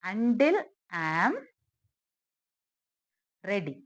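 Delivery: slow dictation with a long wait before the last word; piece by piece. Shown as a demonstration. Teacher building an English sentence for learners, piece by piece. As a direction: hang on the phrase, then complete it.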